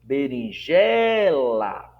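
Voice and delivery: Berinjela very slow, JE loud and stretched; exaggerated penultimate stress. Shown as a demonstration. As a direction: hold out the JE in Berinjela. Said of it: "eu estou ali acentuando".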